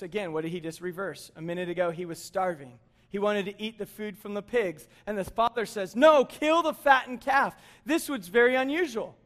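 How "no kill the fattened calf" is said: loud, slow proclamation, pitched up; emphatic fatherly order. Acted. Father calling for a feast, emphatic order. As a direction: proclaim it slowly and loudly to the household.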